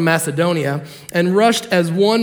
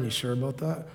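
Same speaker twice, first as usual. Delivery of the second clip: low, soft muttered doubt; a dry low mutter; 7 semitones lower, 15 dB quieter than usual. Retold or imitated. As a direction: mutter it under your breath. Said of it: "Paul might have muttered".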